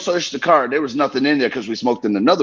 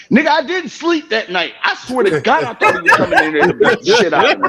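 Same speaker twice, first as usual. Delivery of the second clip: laughing high recall; 9 semitones higher, 3 dB louder than usual. Retold or imitated.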